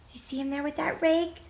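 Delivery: bright, lively storybook read-aloud; reading to children. Acted. Teacher reading a picture book to children, lively.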